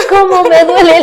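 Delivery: mother teasing her daughter with an over-the-top mock whine; hammed-up whine. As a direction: ham up the complaint to make her laugh. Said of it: "le digo".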